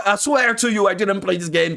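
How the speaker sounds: ordinary talk, nothing marked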